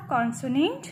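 ordinary delivery

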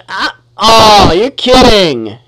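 loud, slow groan of disbelief; can't believe it just happened; drawn-out loud dismay. Real, not acted. Player dies unexpectedly in game.

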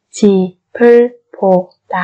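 집을 보다 in four separate syllables. Shown as a demonstration. Slow syllable-by-syllable model. (đọc 집을 보다 chậm từng âm tiết)

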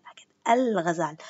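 الغزال with the l sounded; right form. Shown as a demonstration. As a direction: correct al-ghazal, firm. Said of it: "اسمعوني كيف حكيت".

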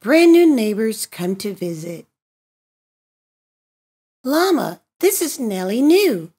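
usual speaking voice

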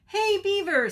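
high, loud hail to a friend; Bird's high, insistent call. Acted. Hungry Bird hails Beaver, calling out.

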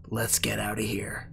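soft conspiratorial whisper. Acted. Freed prisoner to the rescuers, whispered. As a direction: breathe it low like a secret plan.

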